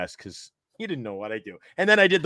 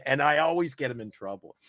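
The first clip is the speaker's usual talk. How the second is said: usual speaking voice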